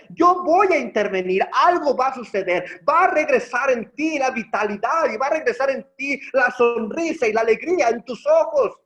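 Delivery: más fuerte, con convicción creciente; proclámalo con esperanza; predicación enfática. Acted. (predicador que alza la voz con fuerza)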